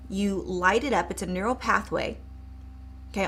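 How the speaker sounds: usual speaking voice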